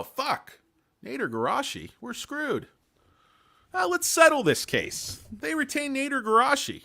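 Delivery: high-pitched mock-panicked little skit voice; hammed-up legal skit. Client introducing his attorney to worried opponents, high hammy skit voice. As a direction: play both sides cowed by a fearsome attorney.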